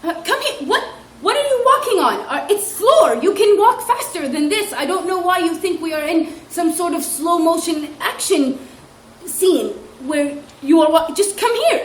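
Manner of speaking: high, loud mock exasperation; exasperated scolding. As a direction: chide a dawdling child with mock outrage. Teacher scolding slow child.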